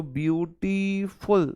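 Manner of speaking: ब्यूटीफुल drawn out slowly in parts; two-beat pronunciation. Shown as a demonstration. Teacher showing students how a word splits, ब्यूटीफुल slowly in two beats. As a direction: stretch ब्यूटीफुल, separating ब्यूटी from फुल. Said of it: "दो बार में आप इसे pronounce कर रहे हो".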